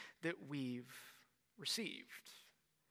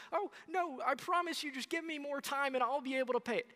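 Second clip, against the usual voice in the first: much higher, fast panicky pleading; begging debtor; 8 semitones higher, faster than usual. Pretend. Debtor begs the loan shark for more time, high and panicked. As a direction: squeak out a frantic promise to pay. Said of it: "And the twenty-four-year-old says".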